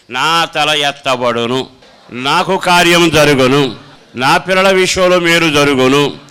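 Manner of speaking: loud, slow proclamation; bold declaration of faith. Shown as a demonstration. Preacher showing the congregation how to speak faith aloud, loud and slow. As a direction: declare each promise with full force, slowly. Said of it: "ఆ విశ్వాస పలుకులు ఎంత గట్టిగా పలుకుతావో".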